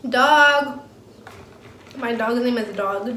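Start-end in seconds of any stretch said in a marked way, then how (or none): 0.1-0.8 loud call to a dog. Real, not acted. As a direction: call the dog from across the room. Calling the dog over.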